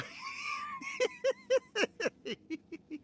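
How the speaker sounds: unrestrained laughing fit; hearty laughter. Real, not acted. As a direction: crack up at the prank. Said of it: "you're laughing?".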